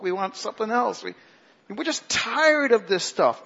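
hammed-up petulant griping, high-pitched; whiny complaining. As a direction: mimic a crowd of whiny grumblers. Acting out the grumbling Israelites.

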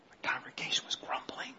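hushed stage whisper; very soft confidential whisper. Pretend. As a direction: whisper it like a secret. Mock secret answer to his question.

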